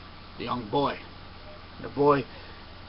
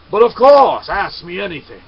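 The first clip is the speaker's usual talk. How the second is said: loud, high, cocky "of course"; boastful shout; 5 semitones higher, 14 dB louder than usual. Acted. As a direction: scoff like he cannot lose. Samurai boasts.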